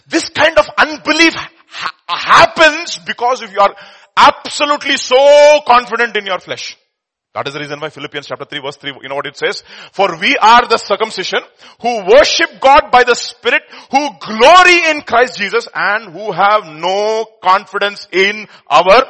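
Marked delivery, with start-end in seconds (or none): 0.1-6.8 rising passionate preaching, slowed; preaching loudly. Real, not acted. Preaching at full force. 9.9-19.0 loud, slow scripture declaration; emphatic scripture reading. Real, not acted.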